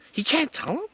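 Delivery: high, soft, baffled question; incredulous. Real, not acted. Reviewer baffled by the friend's refusal, incredulous. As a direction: question it in hushed disbelief.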